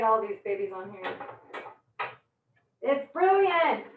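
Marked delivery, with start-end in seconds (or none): 2.8-3.8 slow, high, savoring "brilliant"; drawn-out praise. Real, not acted. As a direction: draw out "brilliant" in delight. Admiring the sliced cabbage.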